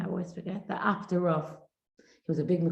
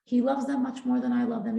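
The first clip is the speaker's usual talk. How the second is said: ordinary talk, nothing marked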